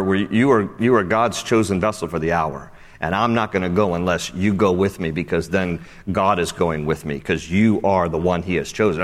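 low, measured, respectful declaration; honoring, resolute. Acted. Preacher voices Barak honoring Deborah as God's chosen, low and steady. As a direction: say it firmly and reverently, pitched low. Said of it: "if he says it that way".

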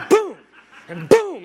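explosive, loud boom; mimed mallet blow. Retold or imitated. Preacher acting out mallet hits on prairie dogs, explosive booms. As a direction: slam it out like a hammer blow.

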